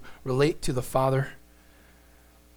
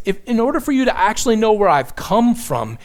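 usual speaking voice

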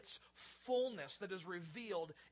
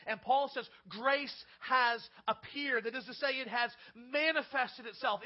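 emphatic preaching; 3 semitones higher, 8 dB louder than usual. Acted.

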